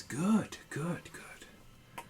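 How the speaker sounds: high first word, dropping to hushed repeats; gloating cleric. Acted. Cleric answering the desperate brother, gloating. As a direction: savour each word, falling away to a whisper. Cleric voiced in game master's story.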